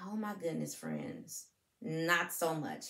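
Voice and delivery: put-on soft, breathy confiding to the kids; hushed aside. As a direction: drop to a near whisper for the sad bit. Sad news about Gerald's dancing.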